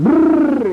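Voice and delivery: shrill trilled brrr, loud and high; high shuddering brrr. Retold or imitated. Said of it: "like that".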